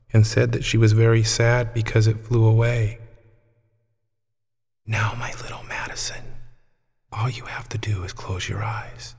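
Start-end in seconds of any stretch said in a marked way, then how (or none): 4.8-9.1 put-on gentle old woman whispering; whispering softly. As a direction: breathe it like a secret to a child. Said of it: "Grandma Melissa whispered softly".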